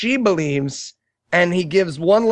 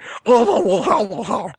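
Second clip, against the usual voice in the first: mock wheezy heavy breathing; imitated heavy breathing; 4 semitones higher than usual. Heavy breathing lady beside him in the theater, mock panting. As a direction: huff and puff like a heavy breather. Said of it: "the heavy breathing lady next to me was like".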